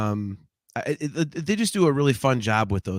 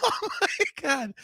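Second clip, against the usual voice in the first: laughing; 9 semitones higher, 3 dB quieter, slower than usual. Real, not acted. After laughing fit.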